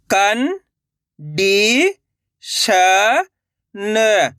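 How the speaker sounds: कन, डी, श, न one by one, held long; direct-matra reading. Shown as a demonstration. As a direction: sound out कन, डी, श, न with pauses. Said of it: "direct मात्रा का उच्चारण करके". (कन, डी, श, न अलग-अलग खींचकर)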